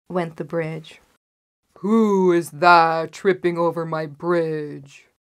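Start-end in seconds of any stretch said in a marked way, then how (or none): 1.8-5.1 loud, drawn-out troll challenge; roaring troll. A narrator's reading. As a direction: roar it slowly like a troll. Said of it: "roared the troll again".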